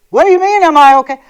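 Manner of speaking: loud, high-pitched retort imitating a rude man; imitated offended outburst; mock indignant. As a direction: act out an affronted caller firing back. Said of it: "talked to me so bad".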